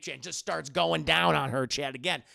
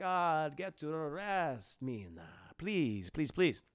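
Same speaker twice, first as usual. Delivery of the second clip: Dracula; 3 semitones lower, 3 dB quieter, much slower than usual. Retold or imitated. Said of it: "He's like".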